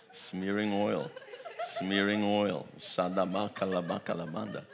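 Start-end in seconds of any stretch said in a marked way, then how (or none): 2.8-4.6 tongues. Real, not acted. Preacher speaking in tongues.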